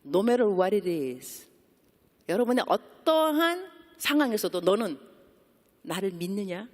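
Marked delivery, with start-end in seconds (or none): 0.1-1.5 very slow, weighty English line; slow, weighty declaration; solemn emphasis. Acted. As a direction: lay each word down slowly and deliberately. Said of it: "하나님이 찾는 믿음이 뭐냐면".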